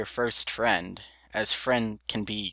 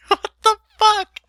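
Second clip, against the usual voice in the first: laughing; 7 dB louder than usual. Real, not acted. Reader cracks up at ending.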